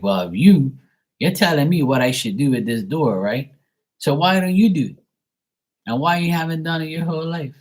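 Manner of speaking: throwing dad's advice back at him, rising high; mock exasperated retort. Son to father, mock challenge: climbing high, pointed. Mocking retort to his dad.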